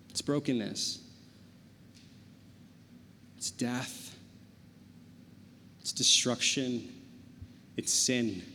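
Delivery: low, hushed, with long pauses; quiet, heavy sermon delivery; somber preaching. Real, not acted. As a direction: let the weight sink in, softly and gravely. Somber preaching after the lively story.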